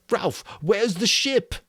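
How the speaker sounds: put-on slow, high boy pleading; anxious Piggy. A narrator's reading. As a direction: beg to be shown. Piggy asking.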